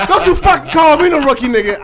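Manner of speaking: high, loud laughing protest; laughing shout at a teasing friend; laughing yell. Real, not acted. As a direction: yell back through the laugh. Teased as rookie, yells back.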